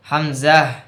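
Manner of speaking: Arabic hamza; hamza demo. Shown as a demonstration. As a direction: clip হামজা at the throat. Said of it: "এখান থেকে উচ্চারণ হবে".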